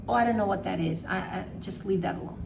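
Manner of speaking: ordinary talk, nothing marked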